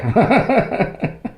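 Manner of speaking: high chuckle; real laugh. Real, not acted. Cook joking about inherited no-seasoning habit, laughing.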